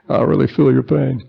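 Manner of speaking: a mock Bill Clinton, feeling the pain; Bill Clinton impression. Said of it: "I was gonna do a Bill Clinton impression here".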